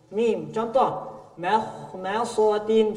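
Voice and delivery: makhmasatin drawn out slowly. Shown as a demonstration. Teacher models makhmasatin. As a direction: say makhmasatin slowly, thin mim.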